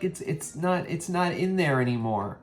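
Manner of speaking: a touch of high mom voice, fading; imitating mom. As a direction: start high like mom, then settle. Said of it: "She's like".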